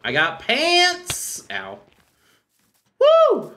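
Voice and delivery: high, slow excited cheer; too much caffeine. Real, not acted. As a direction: squeal it with glee. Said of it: "mentioning how wired I am".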